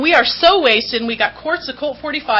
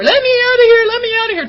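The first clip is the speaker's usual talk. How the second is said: much higher, loud mock yell of a drama queen; yelling to be let out; 11 semitones higher, 4 dB louder, much faster than usual. Locked-up drunk girl shouting at the jailers, hammed-up yell. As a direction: bang on the door and shout to be freed. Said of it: "I'm yelling and screaming".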